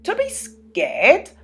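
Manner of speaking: high, slow timid question; puzzled little man. Acted. Mister Bashfellow asks the children, high and slow. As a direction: ask it in wonder. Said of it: "asked Mister Bashfellow".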